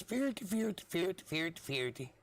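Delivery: high mock Swedish sing-song gibberish. Podcaster mocking how Swedes talk, in high mock Swedish. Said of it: "talking like this".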